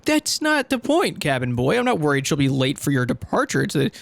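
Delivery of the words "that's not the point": high, slow, indignant scolding; exasperated retort. Acted. Hero scolding the cabin boy, exasperated and high. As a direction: talk down to him in disbelief.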